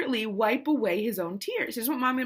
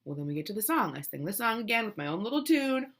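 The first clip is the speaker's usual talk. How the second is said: normal talking voice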